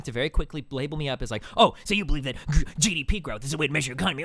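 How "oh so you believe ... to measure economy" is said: fast, sneering mock of his opponent; mocking the debater. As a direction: rattle it off like a smug gotcha. Mocking the Naked Ape guy.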